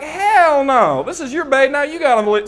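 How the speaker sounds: defiant refusal. Retold or imitated. Defiant reply to woman.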